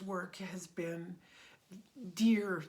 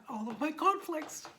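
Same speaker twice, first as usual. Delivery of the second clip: on the verge of tears; 7 semitones higher than usual. Real, not acted. Telling her students about training, close to real tears. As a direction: voice lifting and catching, near crying. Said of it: "I'm gonna cry".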